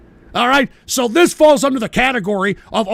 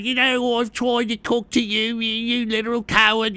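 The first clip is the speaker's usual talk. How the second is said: mock British accent, softer droning voice; Belligerent Brian; 5 dB quieter than usual. Host mimicking his British critic, mock British accent. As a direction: imitate a complaining Brit in a silly accent. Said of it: "And look at Belligerent Brian".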